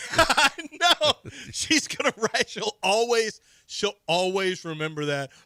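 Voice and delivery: high, loud laughing talk. Real, not acted. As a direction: laugh through the words. Laughing at himself.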